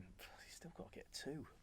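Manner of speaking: slow, breathy whisper to yourself; quiet reminder to yourself, whispered; hushed whisper. Retold or imitated. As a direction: whisper it slowly under your breath. Retold thought during the match.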